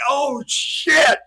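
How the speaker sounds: high, loud horrified reaction; mock shock. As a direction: blurt it out as if appalled. Acts out shock at unmasked face.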